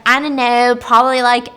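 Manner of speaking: loud, offhand brush-off; dismissive. Retold or imitated. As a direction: brush the question off loudly. Old nurse snapping back, acted.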